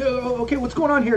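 high, bewildered bandit voice; confused bandit. Acted. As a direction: ask it high and lost, looking around. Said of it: "like, looking".

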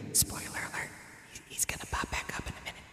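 quick, hushed stage whisper; very soft, fast whisper; whispered aside. Pretend. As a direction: whisper a secret to the listener.

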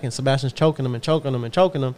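ordinary delivery

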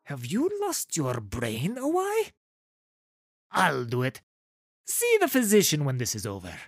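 put-on voice of a scolding soldier; exasperated guard. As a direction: scold in character, quickening at the end. Exasperated man scolds supposed Awai.

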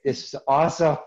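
thrilled at the gold heart; excited. Retold or imitated. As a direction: burst out with joy.

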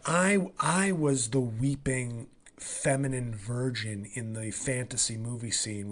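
ordinary talk

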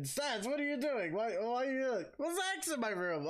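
high mock mom voice, confused and scolding; imitating a mom. Friend imitates a mom finding a stranger in the room, mock scolding. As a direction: play the startled mom bursting in. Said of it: "your mom would come in".

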